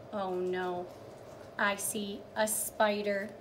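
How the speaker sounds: ordinary delivery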